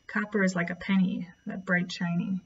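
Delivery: usual speaking voice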